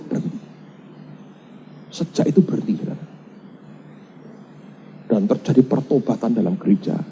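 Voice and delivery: normal talking voice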